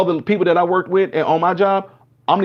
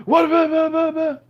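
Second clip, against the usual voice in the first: mock frantic protest; 12 semitones higher than usual. Retelling a heated cop stop.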